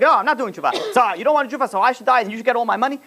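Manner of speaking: high, heated retold bickering; mock fighting match. Spouses fight over tshuva, mock heated squabble. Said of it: "yeah, fighting match".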